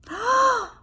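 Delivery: big loud gasp, then long blown-out breath; exaggerated inhale and whooshing exhale; pretend balloon blowing. Shown as a demonstration. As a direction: suck in air and blow up a balloon. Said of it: "pretend to blow up a balloon".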